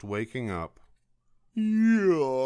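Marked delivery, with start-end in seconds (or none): 1.5-2.4 long, loud, high acted yawn; sleepy yawn. A narrator's reading. Sleepy someone waking in the cave, big acted yawn. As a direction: yawn the word out fully.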